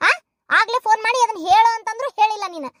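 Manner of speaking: ordinary delivery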